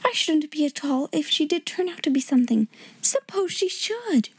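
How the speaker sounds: put-on high-pitched girlish excitement; Jessie breaking out. A narrator's reading. Schoolgirl speculating about the princess, high excited wonder. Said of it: "Jessie broke out".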